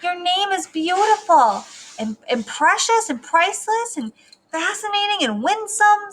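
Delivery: high, lilting praise; doting high-pitched comfort; reassuring parent. Acted. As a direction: gush over your child to cheer them up. Parents comforting their upset child.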